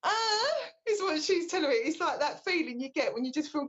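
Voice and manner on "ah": high exasperated "ah"; mock frustrated yelp; frustrated groan. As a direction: let out a sharp frustrated sigh-cry. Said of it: "you're getting really frustrated, going".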